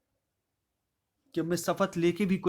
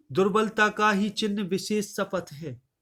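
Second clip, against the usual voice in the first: raised, measured verse recital voice; poem recitation; 4 semitones higher than usual. Acted. Teacher reciting Kaikeyi's verse to the class, declaiming.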